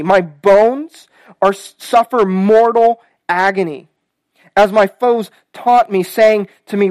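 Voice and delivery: loud, slow psalm lament; impassioned pulpit reading, heavy pauses; scripture reading. Acted. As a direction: read out the anguished plea with force. Reads the psalm aloud.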